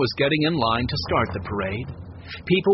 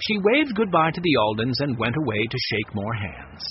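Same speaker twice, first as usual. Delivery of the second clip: plain reading voice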